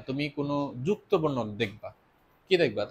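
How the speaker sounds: normal talking voice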